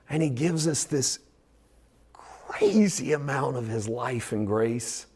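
slow awed whisper; whispering about the wonder of grace; hushed whisper. Real, not acted. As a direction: let it fall to a whisper. Preacher drops to whisper.

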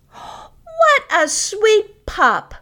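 put-on high, slow cooing baby talk; talking to a dog. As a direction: gush sweetly over the pup. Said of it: "I then say, loud enough for the owner to hear".

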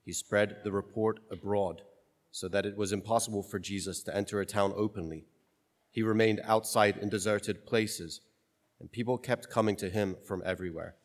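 measured, reverent liturgical reading; solemn gospel reading. Acted.

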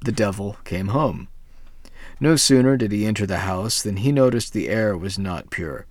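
plain reading voice

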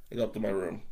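ordinary talk, nothing marked

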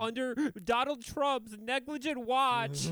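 high, mock-earnest courtroom plea; hammed-up righteous testimony, pitched well up; indignant defendant. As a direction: plead the case in a high, outraged voice.

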